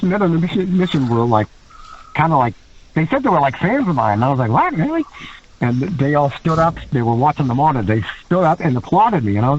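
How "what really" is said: high-pitched disbelief; surprised. Retold or imitated. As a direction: retell it as startled, flattered surprise. Retells surprise at praise from wrestlers.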